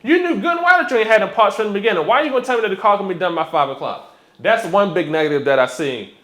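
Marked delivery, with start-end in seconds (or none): 0.0-4.1 higher, fast indignant complaint; worked-up customer confronting the mechanic; triggered customer. Retold or imitated. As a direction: rattle off an exasperated telling-off. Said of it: "I'm kinda triggered".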